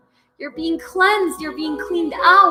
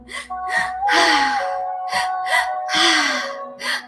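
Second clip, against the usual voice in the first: long sighing exhale; 3 dB quieter than usual. Shown as a demonstration. Demonstrating the release breath.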